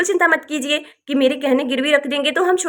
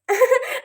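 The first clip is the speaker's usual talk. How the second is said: shy giggle; 7 semitones higher than usual. Acted. Bride teased about mother-in-law.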